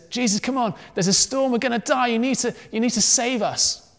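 high-pitched panicky plea; urgent mock panic, pitched well up; scared pleading. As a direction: act out frightened sailors begging for help. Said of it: "the disciples were scared".

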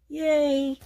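high, bright little cheer of delight; excited cheer. Real, not acted. Crafter cheering over her finished card. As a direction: squeal a happy yay at your own craft.

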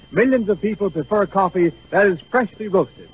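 ordinary talk

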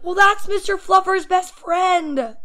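slow, loud, high whine; protesting kid. A narrator's reading. Boy defending another toy from mom, loud whiny protest. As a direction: insist as if it were obvious.

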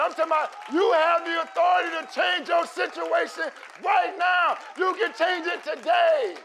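loud, rising preacher cadence, pushing the crowd; full-force preaching. Acted. Pastor rallying the church about authority, preaching at full force. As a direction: preach it hard and build.

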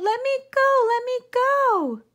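very high, loud panicked squeal; shrill piglet begging to be let go. Acted. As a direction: squeal and kick to get free. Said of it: "The piglet kicked and squealed".

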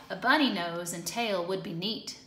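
plain reading voice

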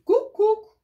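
high, loud eerie cuckoo cry; loud high bird call; scary cuckoo call. A narrator's reading. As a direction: make the dream bird shriek its call. Scary dream bird calls.

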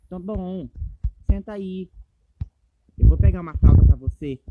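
ordinary delivery

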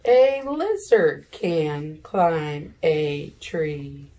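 slow, loud, word by word; teacher pacing the class through the sentences; slow read-along. Shown as a demonstration. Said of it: "you point under the words while I point above".

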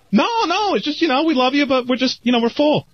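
high-pitched, falsely reassuring let-down; placating. Retold or imitated. Booker letting a rejected comedian down, high and placating. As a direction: squirm upward while softening a rejection.

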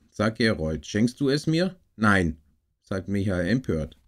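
clearly projected read-aloud storytelling with lively dialogue; story narration. Acted. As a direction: read a children's story aloud in measured phrases with pauses. Liest eine Weihnachtsgeschichte vor. (lebhaft vorgelesene Kindergeschichte)

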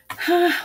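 long high sigh; heavy sigh. Acted. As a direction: sigh in misery. Mole's fit of blues.

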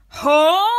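loud, high, incredulous "Home?". Acted. Driver booming at Henry, loud and incredulous. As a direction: bellow it in disbelief. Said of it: "replied the driver just as loudly".

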